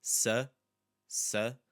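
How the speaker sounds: sa alone, then sapato in three separate pieces; first syllable isolated. Shown as a demonstration. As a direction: break up sapato, reduced sa and open PA. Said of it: "focus on the first one".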